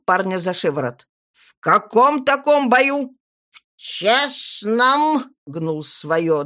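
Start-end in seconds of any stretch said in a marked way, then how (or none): 1.3-3.2 angry. A narrator's reading. Wizard interrogating. 3.5-5.2 put-on slurred, slow drunken voice; stubborn tipsy lad insisting proudly; town drunk. A narrator's reading.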